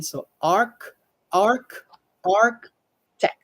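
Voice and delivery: "arc" then "architect" said slowly with a hard K. Shown as a demonstration. Teacher showing ch sounds like k. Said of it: "The C H sounds like, like K".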